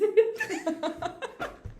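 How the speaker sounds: high-pitched squealing giggle; high giggle burst. Real, not acted. Laughs after greeting.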